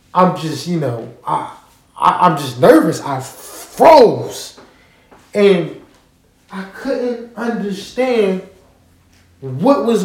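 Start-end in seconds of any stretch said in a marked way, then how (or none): none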